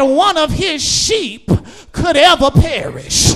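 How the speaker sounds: loud, building preacher cadence; preaching at full force. Acted. Preacher to his congregation, preaching at full force. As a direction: swell the voice toward the peak.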